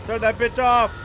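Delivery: loud, high-pitched yell; urgent shout. Real, not acted. Player desperate to get the TV off, shouting. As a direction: shout the order in alarm. Order repeated, now shouted.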